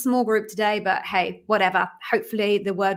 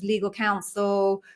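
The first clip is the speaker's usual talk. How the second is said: ordinary talk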